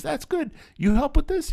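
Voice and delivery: high-pitched impression of an encouraging director; Quentin Tarantino. Director asks kid to help, eager high voice. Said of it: "And he goes".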